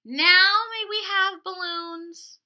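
loud, slow pleading call; eager loud question. Acted. Isabel asks Miss Quill for balloons, loud eager plea. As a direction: beg the teacher loudly.

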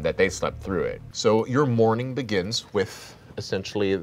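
normal talking voice

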